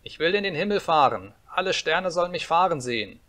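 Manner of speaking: put-on high, insistent boy's voice; demanding little boy. A narrator's reading. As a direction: demand it like a bossy child. Boy making demands in story. (fordernder kleiner Junge)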